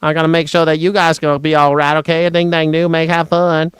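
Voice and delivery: put-on flustered Southern politician making excuses in a thick accent; hammed-up Southern gentleman impression; Lindsey Graham. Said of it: "I think Lindsey Graham probably gets real nervous".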